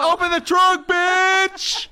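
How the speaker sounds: hammed-up shout at the driver; mock yell. Joking voice of a passenger shouting to open the trunk. Acted demand from the car.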